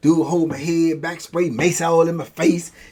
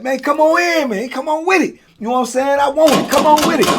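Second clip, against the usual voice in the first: higher, louder taunt: come get me; defiant dare; 12 semitones higher, 5 dB louder than usual. Retold or imitated. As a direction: challenge the guards to bring it. Daring guards to come in.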